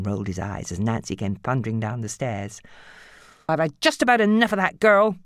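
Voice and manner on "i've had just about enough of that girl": higher, louder grumble; mother fuming about her daughter. Acted. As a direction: vent to her father. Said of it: "she fumed".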